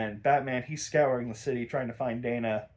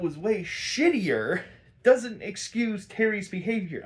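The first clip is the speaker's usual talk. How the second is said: heated rant; 7 semitones higher, 4 dB louder than usual. Real, not acted.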